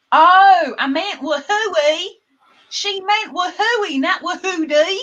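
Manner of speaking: high, exclaimed realization; playful excitement. Pretend. Host suddenly correcting her own whoop, playful excitement. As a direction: burst out with a loud "Oh", stay high.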